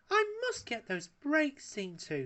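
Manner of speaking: high, slow, giggly lady's voice. A narrator's reading. Paella giggles to Wallace about her brakes. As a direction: coo it with a giggle. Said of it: "she giggled".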